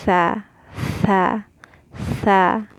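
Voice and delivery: tha with an open a and tongue-out th; tha, tha, tha demo; fatha sound. Shown as a demonstration. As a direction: repeat tha clearly. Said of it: "it'll sound like tha".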